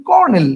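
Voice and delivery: কর্নেল in two syllables, "kor-nel"; correct spoken form. Shown as a demonstration. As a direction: say কর্নেল with r, no middle o. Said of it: "আমরা উচ্চারণ করি".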